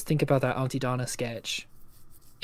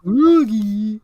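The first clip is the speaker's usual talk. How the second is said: higher, louder, slow dopey cat call; Big the Cat; 6 semitones higher, 7 dB louder, much slower than usual. Pretend. Searching for Froggy, slow dopey cartoon cat. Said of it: "a fun impression for me".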